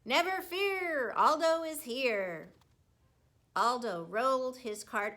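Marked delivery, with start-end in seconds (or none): 0.0-2.5 big booming entrance, slowed; hammed-up heroic roar; mock roar. A narrator's reading. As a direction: announce yourself like a hero arriving. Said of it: "voice roared".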